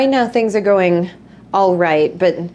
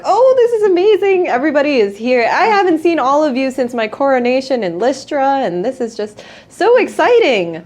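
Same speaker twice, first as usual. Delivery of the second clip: gushing; 6 semitones higher than usual. Acted. Burkor thrilled to see old friends, high and gushing. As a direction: squeal over seeing everyone. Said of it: "Burkor's like".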